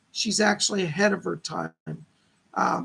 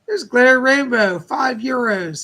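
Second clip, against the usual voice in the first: excited; 4 semitones higher, 8 dB louder than usual. Real, not acted. Greeting a donor excitedly.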